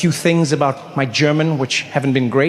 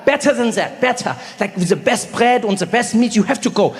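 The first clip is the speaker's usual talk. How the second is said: put-on higher, keen German-accented friend; 6 semitones higher than usual. Friend pitching a better sandwich place, German accent. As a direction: sell it eagerly in a German accent. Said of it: "He's like".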